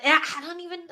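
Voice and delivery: ordinary delivery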